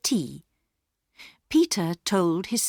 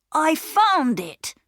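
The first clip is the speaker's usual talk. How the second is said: put-on higher, slower, pleased boy; proud boy; 10 semitones higher, 5 dB louder, slower than usual. A narrator's reading. Peter showing the girls the coal, proud and higher. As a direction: show off the find slowly.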